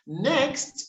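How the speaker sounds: normal talking voice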